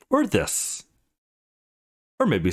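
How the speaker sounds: a playful put-on squeak. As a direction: strike a silly pose with the voice. Striking poses for her friend.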